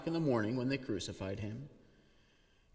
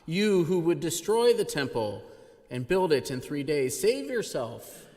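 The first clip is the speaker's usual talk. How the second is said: louder, higher jeering at a dying man; mocking taunt; 4 semitones higher, 6 dB louder than usual. A narrator's reading. Passersby jeering at the crucified Jesus, loud mocking. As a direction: sneer it like a scoffing passerby. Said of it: "derided him".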